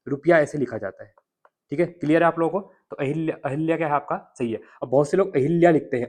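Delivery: ordinary talk, nothing marked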